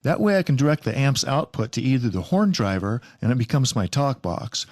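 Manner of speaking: ordinary talk, nothing marked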